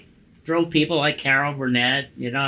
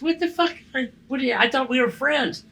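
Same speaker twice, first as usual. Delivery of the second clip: imitating a hurt friend, much higher; retold shocked outrage; 9 semitones higher than usual. Kevin caught off guard on the phone, imitated in high mock outrage. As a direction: squeal it in mock disbelief. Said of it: "He goes".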